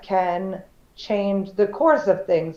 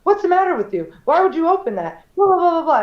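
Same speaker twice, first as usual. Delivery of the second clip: mom's raised, higher scolding; mom upset; 8 semitones higher, 3 dB louder, faster than usual. Retold or imitated. Mom scolding the dollar store cashier, raised and higher. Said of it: "my mom got very upset".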